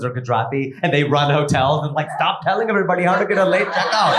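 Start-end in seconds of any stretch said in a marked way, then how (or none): 2.2-4.1 mock scolding. In-laws scolding the comedian.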